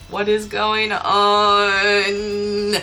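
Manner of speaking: long drawn-out, held mock cry of bewilderment; mock silent scream. Imagined plea from model's eyes during the shoot, mock screaming. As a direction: stretch it out as a sustained imitation wail. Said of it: "her eyes were screaming".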